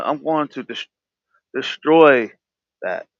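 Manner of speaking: usual speaking voice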